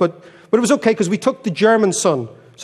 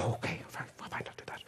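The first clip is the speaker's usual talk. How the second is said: a husband caving under his breath; whispered meek agreement; 21 dB quieter than usual. Retold or imitated. Husband meekly agreeing to his wife's plan, whispered. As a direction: whisper it, giving in.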